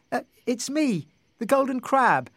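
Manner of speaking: high-pitched little crab. A narrator's reading. Golden crab introduces itself to the surprised couple, little voice. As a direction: pipe up in a small, high voice. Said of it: "said the voice again".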